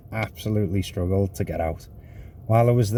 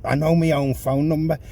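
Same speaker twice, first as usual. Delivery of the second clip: mock indignant passenger, raised pitch; frustrated; 6 semitones higher than usual. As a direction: imitate mild exasperation. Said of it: "She's getting frustrated at me".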